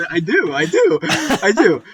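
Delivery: loud, high, laughing through the words; laughing admission. Real, not acted. Host confessing music taste to co-host, laughing. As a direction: admit it while cracking up. Amused answer to a friend's teasing.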